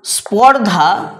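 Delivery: স্পর্ধা said slowly, spor then dha; carefully spelled-out স্পর্ধা; slow word demo. Shown as a demonstration. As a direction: draw out স্পর্ধা in two clear beats. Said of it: "যখন বলছিলাম স্পর্ধা".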